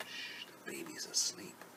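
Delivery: soft, whispered shush; hushing whisper. Acted. Mommy telling the mouse baby's asleep, hushed whisper. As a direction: whisper it to keep a baby asleep. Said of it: "telling him that".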